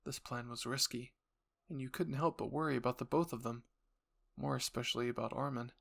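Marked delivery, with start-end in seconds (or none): none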